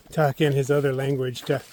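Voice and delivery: normal talking voice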